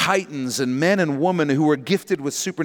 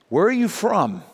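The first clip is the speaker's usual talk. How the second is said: nervous question; 6 semitones higher than usual. Acted. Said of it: "he asks nervously".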